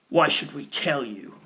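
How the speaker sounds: slow, low snarl with a changed voice. A narrator's reading. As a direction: hostile boy snarling the question slowly. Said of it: "his brother snarled".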